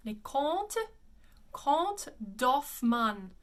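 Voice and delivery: "Les Comtes" as lay KONT, one syllable, then doff-MAN; correct French. Shown as a demonstration. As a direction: slowly model French "Comtes Doffman", silent endings. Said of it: "It is".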